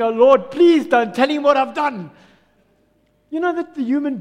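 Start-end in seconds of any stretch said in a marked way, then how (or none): 0.0-2.1 loud, urgent mock plea; pleading. Sinner begging the Lord not to tell, pleading. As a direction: cry out a prayer, louder. Acted anxious prayer to God.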